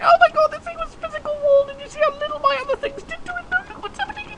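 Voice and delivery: very high squealing mock-fan voice; hammed-up squeaky gushing over the game; excited [name]. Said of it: "[name] is once again creaming his pants".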